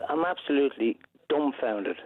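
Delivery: ordinary talk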